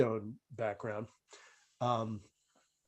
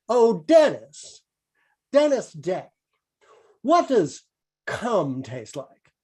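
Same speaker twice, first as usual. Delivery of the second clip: higher, louder, slow mock Benny; drawn-out radio comedian; Jack Benny impression; 5 semitones higher, 3 dB louder, slower than usual. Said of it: "It's Jack Benny".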